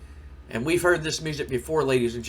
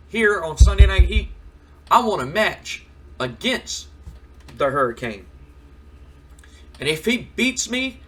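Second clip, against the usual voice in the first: defiant challenge; 5 semitones higher, 4 dB louder than usual. Acted. Lawler issuing a challenge.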